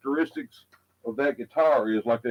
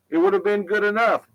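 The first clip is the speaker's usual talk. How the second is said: higher, put-on voice echoing the line; mock echo voice; 8 semitones higher than usual. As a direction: say it again higher, as someone else. Repeats the line in a different voice.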